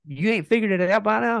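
high-pitched, amazed rhetorical question; incredulous. Retold or imitated. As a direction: exclaim it as if it were obvious. Retells amazed reply to caller.

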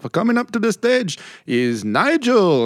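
put-on high, slow Indian-accented stage announcer; drawn-out Indian-accented emcee call. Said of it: "there was this Indian guy".

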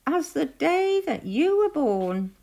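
singled-out, poking-fun animal voice; teasing Weasel. A narrator's reading. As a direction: lift the voice into a taunt. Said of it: "teased Weasel".

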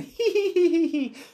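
very high mock villain titter; villain giggle. Introducing the smiling man, gleeful high giggle. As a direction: titter like a creepy cartoon villain.

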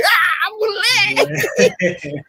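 high, drawn-out cry of surprise; surprised shout. Retold or imitated. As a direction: yell it out in sheer disbelief. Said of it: "Eu falei".

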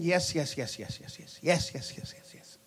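rapid yeses trailing away to a whisper; fading repeated assent. Acted. Preacher mimics believers endlessly agreeing to prophetic words, fading to a whisper. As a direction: repeat it like a chant dying out into a whisper.

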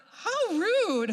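high, laughing mock outrage; indignant. Woman reacting to the pastor's odd words, mock indignant. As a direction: play up being scandalized, pitched high.

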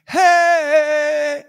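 long, loud, high-pitched holler; big drawn-out call through the house; hollered greeting. Retold or imitated. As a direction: holler it on coming home, holding the vowel. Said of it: "I hollered".

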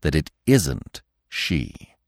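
low, slow, grave insistence; old stag. A narrator's reading. Old stag correcting Bambi, low, slow and firm. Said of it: "the old stag said".

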